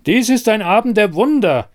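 high, loud proclamation; calling out. Acted. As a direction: cry out the wonder to the room. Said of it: "rief plötzlich jemand".

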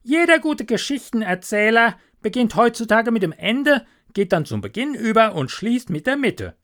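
lightly voiced self-important rat; opinionated water rat. Water rat lecturing the linnet on storytelling, self-important.

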